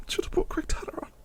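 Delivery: soft fast whisper; regretful whisper. Real, not acted. Manager whispering his regret after losing.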